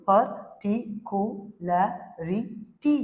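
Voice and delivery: "पर्टिकुलैरिटी" slowly as पर, टि, कु, लै, रि, टी; six separate beats on "पर्टिकुलैरिटी"; syllable by syllable. Shown as a demonstration. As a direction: split "पर्टिकुलैरिटी" into all its syllables. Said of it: "अलग-अलग करके देखो".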